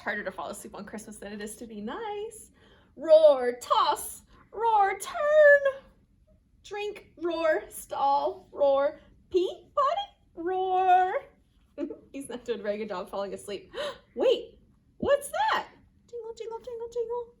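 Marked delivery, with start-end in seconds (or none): 3.0-11.1 loud roars between high action words; playful, rowdy bedtime roars; restless dinosaur roaring. Acted. 14.1-15.7 high, loud surprise after a gasp; sudden wondering alarm; startled question. A narrator's reading. Narrator reacts to a noise. 16.1-17.3 high, light bell-like jingles; small, tinkling high voice; jingling bells. A narrator's reading. Imitates distant sleigh bells.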